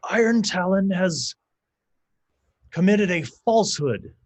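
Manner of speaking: high, slow, solemn proclamation. Acted. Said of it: "the shaman says".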